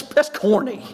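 ordinary talk, nothing marked

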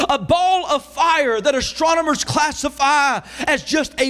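driving, emphatic reading from the pulpit; sermon cadence, pressing phrase after phrase; full-force preaching. Real, not acted. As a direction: read it out with mounting awe. Preaches quoted passage at full force.

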